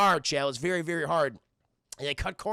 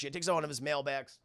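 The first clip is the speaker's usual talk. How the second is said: ordinary delivery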